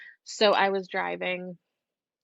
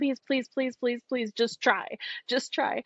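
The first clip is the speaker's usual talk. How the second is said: higher-pitched, repeated pleading please; imitating friend begging; 6 semitones higher, slower than usual. Friend begging [name] to read her sick mom, pleading. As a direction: beg like a desperate friend. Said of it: "kind of begging me while I'm driving".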